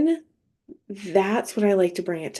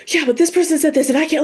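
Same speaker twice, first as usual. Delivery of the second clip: put-on fast, high, louder child's complaint; worried spiraling; 8 semitones higher, 5 dB louder, faster than usual. Worried child coming to a parent, spiraling. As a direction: rattle it out in an acted fluster. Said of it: "spiraling out on something and worry".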